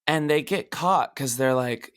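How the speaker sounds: ordinary delivery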